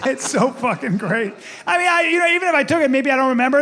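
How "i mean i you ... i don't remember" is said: put-on high, stammering kid excuse; backpedaling young son. Caught son explains himself to dad, high stammering voice. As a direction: scramble for an excuse, pitched up.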